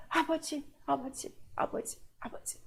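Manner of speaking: fading to a breathless whisper; whispered prayer. Acted. Believer praying to the Father, dropping to a whisper.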